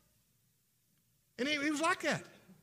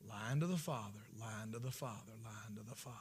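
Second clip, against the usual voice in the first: each repeat softer, dying to a whisper; fading repetition; 8 semitones lower, 10 dB quieter than usual. Real, not acted. Preacher lets the accusation die away to the congregation. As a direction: let the phrase fade out.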